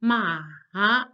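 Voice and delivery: Mandarin third tone dip. Shown as a demonstration. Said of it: "it sounds like this".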